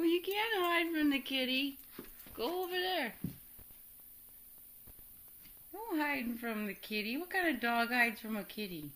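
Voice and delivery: teasing. Real, not acted. Teasing dog who hides.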